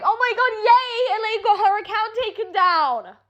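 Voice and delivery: high-pitched mock cheering; mock celebration. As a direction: imitate gloating onlookers, pitched well up. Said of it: "everyone's like".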